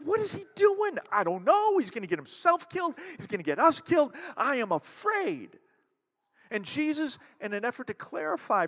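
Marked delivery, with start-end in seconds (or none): 0.0-5.6 high, loud, panicky voice of scared followers; panicked followers. Retold or imitated. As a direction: blurt it out in a frightened rush. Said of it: "his followers are freaking out. They're like".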